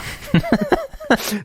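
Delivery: high burst of laughter; real laugh. Real, not acted. Laughing at own joke.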